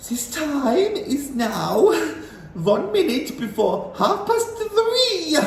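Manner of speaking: high, slow witch's announcement; high slow villain voice; witch announcing. A narrator's reading. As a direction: proclaim it slowly like a grand witch. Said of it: "announced the Grand High Witch".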